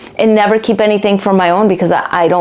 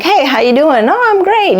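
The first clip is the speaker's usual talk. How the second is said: fake polite cheer; 7 semitones higher than usual. Polite small talk, fake cheer.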